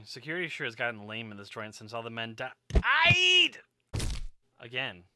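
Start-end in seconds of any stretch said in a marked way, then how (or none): 2.7-3.6 sudden high yelled outburst; shouted died. Acted. Yorick blurting to himself about the dead men, loud yell. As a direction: yell the word out of nowhere.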